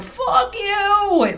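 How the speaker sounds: high mock yell of a rude driver; imitating an angry driver. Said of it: "Yelling back at me like".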